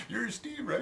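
high-pitched mock yell; imitated calling back. Dog's owner checking who Steve is, high mock yell. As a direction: imitate a neighbour calling over, voice way up. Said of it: "he yells back".

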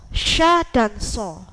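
"Sya dan so" said slowly, sya with rounded sh, so with thick s; slow, careful sya then so; contrast sya and so. Shown as a demonstration. Teacher slowly contrasting "Sya dan so" for students. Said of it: "Bedakan antara sya dan so".

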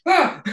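a very high, loud yelp of alarm; startled shriek. Retold or imitated. Waking up late for the radio show, high panicked yelp. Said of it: "And I went".

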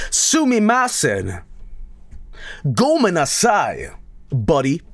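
high, drawn-out Japanese apology; hammed-up anime-style sorry; mock Japanese apology.

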